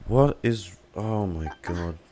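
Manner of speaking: ordinary talk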